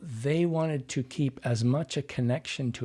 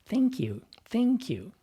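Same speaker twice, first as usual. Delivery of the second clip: very high, eager-to-please "Thank you"; placating thanks; 10 semitones higher than usual. Shown as a demonstration. Voice coach imitates people who don't want to offend, high placating thanks. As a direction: pitch it way up, smiling and unthreatening. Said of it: "you have people who say".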